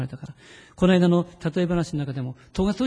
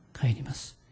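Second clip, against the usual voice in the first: whispered resolve; 3 semitones lower, 7 dB quieter than usual. Shown as a demonstration.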